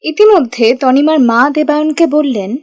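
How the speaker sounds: ordinary talk, nothing marked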